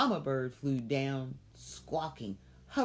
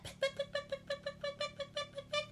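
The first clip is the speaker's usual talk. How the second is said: high, clipped peck peck peck; mama bird pecking the cat; pecking sounds; 6 dB quieter, faster than usual. Acted. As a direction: tap it out fast like a beak. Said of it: "just like that".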